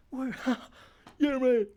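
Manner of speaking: high-pitched mock laughter with whoops; hammed-up laughing. Pretend comic after a crude punchline, hammed-up laughing. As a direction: laugh along at your own joke, high and silly.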